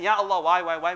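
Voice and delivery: loud exasperated why, why; the wrong way to complain to God; complaining demand. Shown as a demonstration. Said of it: "we don't complain".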